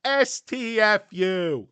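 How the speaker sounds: loud, high, drawn-out letters; exasperated spelled-out yell, played for laughs; mock outburst. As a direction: shout each letter slowly.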